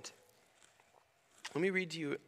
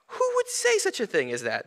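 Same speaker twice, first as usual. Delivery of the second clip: demanding; 8 semitones higher, 5 dB louder than usual. A narrator's reading. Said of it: "they demanded".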